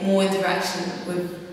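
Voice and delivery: usual speaking voice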